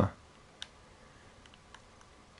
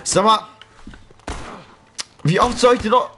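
exasperated rant; 8 semitones higher than usual. Real, not acted. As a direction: vent at the enemy who won't die. Raging at unkillable enemy.

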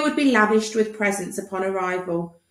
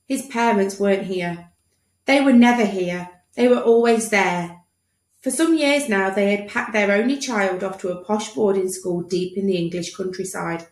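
ordinary delivery

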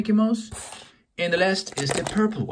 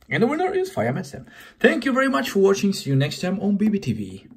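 quick, louder cheerful outro; excited fast sign-off; 4 semitones higher, 3 dB louder, faster than usual. Real, not acted. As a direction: rush the goodbye with excitement.

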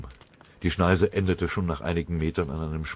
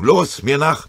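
laut und höher befohlen; befehlend; 8 semitones higher, 8 dB louder, slower than usual. Acted. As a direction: kommandier sie scharf vorwärts. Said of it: "befahl er". (lauter, höherer Befehl)

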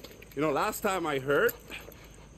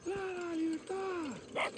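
much higher, soft, drawn-out mockery; mocking falsetto; 7 dB quieter, slower than usual. [name] mocks the names Dani drops, teasing falsetto. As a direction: parrot the names in a teasing high voice.

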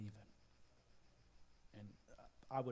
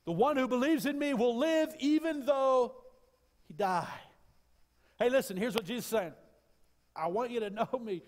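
high, loud climactic preaching cry; full-throated revival preaching; preaching at full force; 12 semitones higher, 13 dB louder than usual. Acted. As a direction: lift it to a soaring shout from the pulpit.

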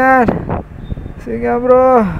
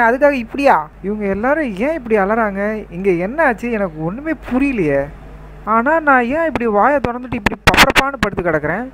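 usual speaking voice

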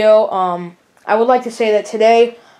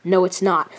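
usual speaking voice

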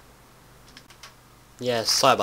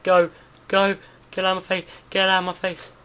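loud, higher yells at the mob; real frantic shouting; 10 semitones higher, 6 dB louder than usual. Real, not acted. Fights off a mob.

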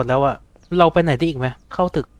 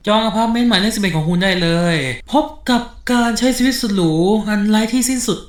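higher, bright-sold fake commercial read; mock ad voice; 5 semitones higher than usual. Gamer reads the game's apartment sign as a mock commercial. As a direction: sell the endless apartment like a TV ad.

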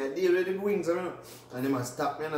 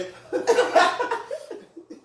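high shrieking laugh; 7 dB louder than usual. Real, not acted. Friends cracking up.

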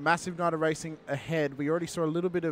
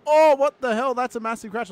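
loud, high shocked outburst; shocked shout; 10 semitones higher, 12 dB louder than usual. Retold or imitated. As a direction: yell it like you just saw a huge crash. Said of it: "my mate's like".

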